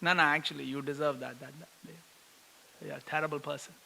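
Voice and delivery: soft, low deadpan pronouncement; calmly telling him he deserves it; hushed matter-of-fact judgment; Gopinath's quiet verdict. Pretend. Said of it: "Gopinath just walked down".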